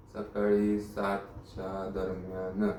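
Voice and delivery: slow, low and soft; writing it down. Acted. Inspector noting the time of the kidnapping, slow and low. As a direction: draw the words out as the pen moves. Repeating details while writing them down.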